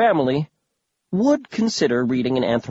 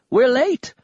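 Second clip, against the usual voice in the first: much higher, louder excited cry; exclaiming loudly; 8 semitones higher than usual. Acted. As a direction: shout it in an old man's panic. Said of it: "Grandpa Smedry exclaimed".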